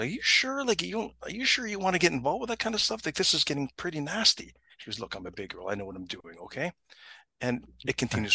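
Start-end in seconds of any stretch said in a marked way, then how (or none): 0.1-4.5 high-pitched, doubtful urging; concerned warning. Retold or imitated. Friend warning her off a nasty situation, high and concerned. 5.0-6.7 low, soft brush-off; cool self-assurance. Retold or imitated. Woman telling her worried friend off, low and self-assured.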